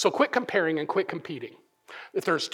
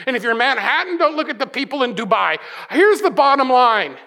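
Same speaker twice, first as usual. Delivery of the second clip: raised, louder voice driving at the congregation; full-force preaching; 8 semitones higher, 7 dB louder than usual. Acted. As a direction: preach it hard, pitch up and emphatic.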